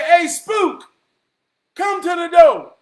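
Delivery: high loud shout across the room; calling out. Retold or imitated. Paul and Jesus yelling for the Holy Spirit, loud. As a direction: call out for the spook.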